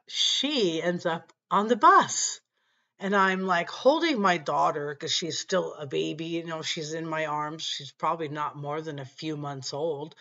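ordinary delivery

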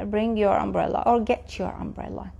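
ordinary talk, nothing marked

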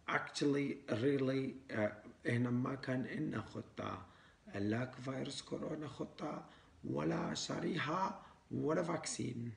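foreigner mangling Arabic words; Arabic with a Western foreign accent; broken Arabic foreign accent. Acted. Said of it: "إحنا لازم نحكي عربي مكسر".

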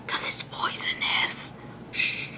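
hushed secret whisper; whispered aside about poison. Acted. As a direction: lean in and whisper it.

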